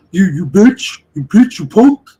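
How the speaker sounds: a loud mock impression of someone cursing people out; mock yelling insults. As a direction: bellow the insults like the angry guy. Imitating the guy's insults.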